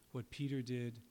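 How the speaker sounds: ordinary delivery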